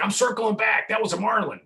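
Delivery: high, loud excited call; the captain shouting that he's turning back. Retold or imitated. Charter captain telling the crew he's circling back, excited.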